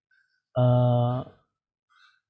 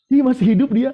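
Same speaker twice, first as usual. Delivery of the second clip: delighted laughing; 6 semitones higher than usual. Real, not acted. Preacher on seeing the sick one alive, delighted laugh.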